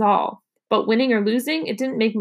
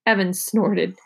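ordinary delivery

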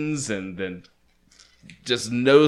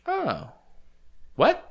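much higher, baffled squeak; mock disbelief; 6 dB quieter than usual. Friend imitating the soldiers' high, baffled reaction. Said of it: "the other guys are like".

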